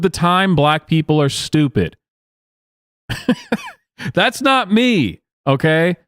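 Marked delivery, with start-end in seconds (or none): 3.1-3.7 high-pitched giggle. Real, not acted. Reacting to the clip.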